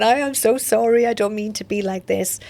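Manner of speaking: a mild replay of crying while saying sorry; retold tearful apology. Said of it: "And then I'd start crying, going".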